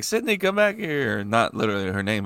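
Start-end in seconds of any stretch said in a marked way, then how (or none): 0.0-1.2 higher-pitched mock calling; imitated chef calling. Imitating hibachi chef calling girl back, higher calling voice. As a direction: call her back, lightly voiced. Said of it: "then he was like".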